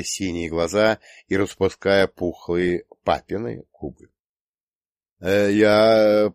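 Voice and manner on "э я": drawn-out, stammering bleat. A narrator's reading. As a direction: bleat it out, lost for words. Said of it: "проблеял он". (растерянно блея, протяжно)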